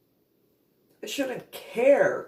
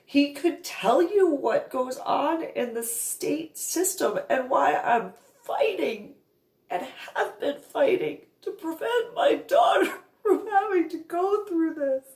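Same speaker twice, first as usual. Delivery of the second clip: much higher, sobbing and shouting; furious through tears, holding nothing back; crying rant; 10 semitones higher than usual. Retold or imitated. Tearful rant at mother.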